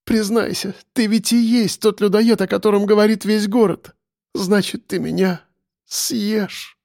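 high, fearful voice of a captive; frightened accusation. A narrator's reading. Captive confronts suspected cannibal, frightened.

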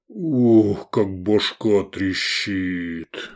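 slow hungover groan, falling away; moaning. A narrator's reading. Waking drugged gladiator complaining, slow pained moan. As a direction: groan through a splitting head. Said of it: "простонал сын Ерная".

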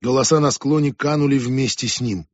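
ordinary delivery

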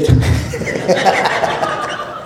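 airy, unvoiced laugh; breathless laugh. Real, not acted. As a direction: laugh out silently at the joke. Audience laughing at running gag. (cười không thành tiếng)

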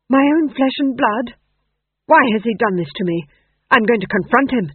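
loud, high indignant outburst; outraged king. A narrator's reading. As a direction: declaim in royal outrage. Ghost king outraged at betrayal.

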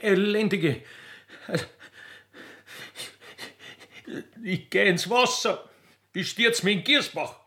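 high voice, spitting out Hure; hammed-up betrayed lover; jealous outrage. Jilted poacher insulting the fainted girl, high and scornful.

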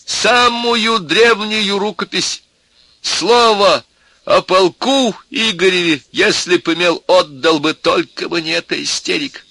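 high-pitched wailing offers; tipsy desperate cry. A narrator's reading. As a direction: bargain loudly in tipsy despair. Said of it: "вскричал пьяненький профессор в величайшем горе".

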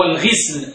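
"الغسل" with i after the ghayn: ghisl; كسر الغين. Shown as a demonstration. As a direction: say "الغسل" as al-ghisl, short i vowel. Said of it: "هكذا بكسر الغين". (نطق "الغسل" بكسر الغين)

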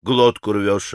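low, understated rudeness; slow, low, impolite rebuke; rude retort. A narrator's reading. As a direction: answer with surly disrespect, keeping it low. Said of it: "с какой-то непонятной даже невежливостью".

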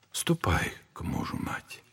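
a soft whispered blessing. Acted. Elder sending the grieving mother home to her husband, whispering.

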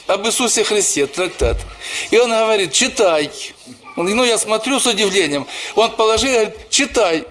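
ordinary talk, nothing marked

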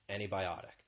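"antibiotic" with the T after the N gone, sounding like "anebiotic"; dropped T. Shown as a demonstration. Teacher repeats the American "antibiotic" without the T. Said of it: "you don't really hear that T sound".

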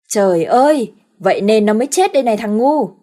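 loud furious reprimand; angry scolding. Acted. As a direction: chew out the fool. Father scolds son.

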